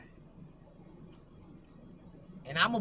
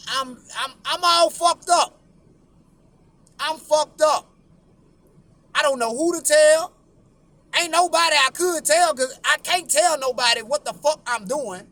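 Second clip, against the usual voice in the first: excited panic; 5 semitones higher, 7 dB louder than usual. Retold or imitated. Panicked boy's first ejaculation.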